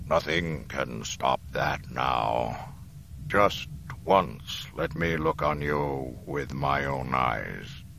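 slow, tired dying voice; worn-out, drawn-out pleading; weary Darth Vader. Acted. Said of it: "The Dark Lord's voice was weary".

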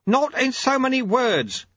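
high, drawn-out call; calling back at controlled volume; shouted. A narrator's reading. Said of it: "shouted Lutze".

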